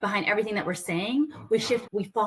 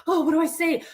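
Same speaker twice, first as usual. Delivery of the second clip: high, louder flustered outburst; mock panic; 8 semitones higher, 5 dB louder than usual. Coach caught off guard by "what do you do", mock panicked. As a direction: act suddenly flustered, voice jumping up. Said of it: "we feel that panic".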